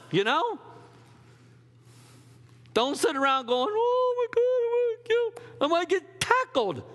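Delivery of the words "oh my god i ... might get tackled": high squeaky scared voice, mocking; hammed-up fretting player; mock panic. Preacher mocks a timid player, high panicky squeak.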